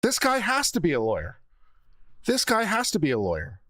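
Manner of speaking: exasperated. Real, not acted. Exasperated rant at lawyer.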